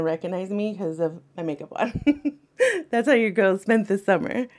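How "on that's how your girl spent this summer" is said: loud laughing through words. Real, not acted. Laughing at her own joke.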